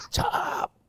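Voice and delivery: drawn-out whip-crack noise; vocal sound effect of a hard lash; imitated lash sound. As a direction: stretch out the crack of a rattan cane.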